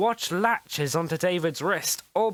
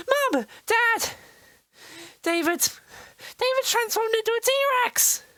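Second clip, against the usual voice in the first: put-on girl running to her parents in panic; panicked cry. A narrator's reading. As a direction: blurt the news in a panic.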